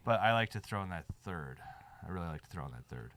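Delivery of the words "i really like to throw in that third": ordinary talk, nothing marked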